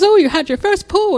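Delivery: high, excited nurse voice; cheerful mock nurse cheer; imitating the nurse. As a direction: mimic her delighted nurse. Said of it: "And she has this great accent".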